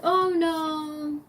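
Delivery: high put-on "oh, no"; lightly mock men brushing off an offer of help; feigned innocence. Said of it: "And like".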